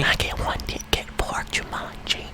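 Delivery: whispered customer order; imitating a whisper. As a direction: whisper the order like a shy moviegoer. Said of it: "whispering their order to me".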